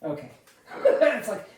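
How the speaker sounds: ordinary talk, nothing marked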